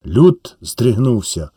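ordinary delivery, nothing marked

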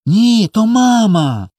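put-on high, drawn-out childish protest; insistent child. A narrator's reading. Small boy assures his brother it is mama, insistent. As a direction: insist in a high, slow, stretched voice. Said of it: "завіряв Коля".

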